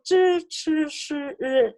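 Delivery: "zhi, chi, shi, ri" with the tongue rolled up; retroflex sounds. Shown as a demonstration. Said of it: "retroflex sound".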